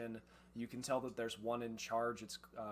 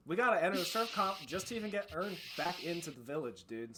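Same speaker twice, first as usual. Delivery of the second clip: louder, higher surfer bro complaint; aggrieved surfer bandit; 7 semitones higher, 8 dB louder than usual. Acted. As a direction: vent like a wronged surfer dude.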